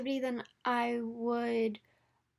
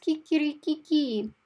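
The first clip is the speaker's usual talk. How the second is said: kikirikiki crowed higher and louder; rooster crow; 5 semitones higher, 6 dB louder than usual. Shown as a demonstration. Teacher showing the class a rooster's call, higher crowing. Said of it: "like a rooster or a chicken".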